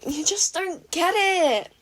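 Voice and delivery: slow, high, drawn-out despair; mock despair at Izuku; exasperated whine. A narrator's reading. May despairing at Izuku, exasperated whine.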